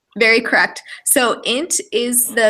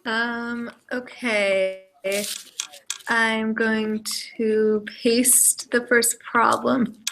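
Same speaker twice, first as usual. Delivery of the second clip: hoarse, strained voice; losing voice; 5 dB quieter, much slower than usual. Real, not acted. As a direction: speak through a failing voice. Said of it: "I'm losing my voice a little bit".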